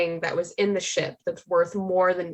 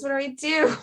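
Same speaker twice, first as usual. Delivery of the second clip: "what do I do?" pitched higher in panic; panicked; 7 semitones higher than usual. Acted. Character asking her new friends for help, higher and panicked. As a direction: repeat it climbing, begging for help.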